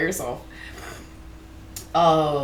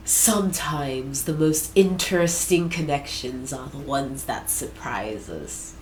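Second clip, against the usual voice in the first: low, slow, soft temptress voice; a lightly voiced seductress, slow and hushed; sultry seductress; 5 semitones lower, 4 dB quieter, slower than usual. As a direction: purr it slowly like a seductive flirt.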